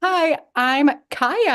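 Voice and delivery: drawn-out mock greeting; lightly voiced famous writer; imitating her.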